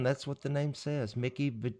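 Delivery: usual speaking voice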